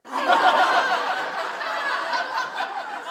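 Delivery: breathy amused laugh; a short real laugh. Real, not acted. Laughs at own brain joke.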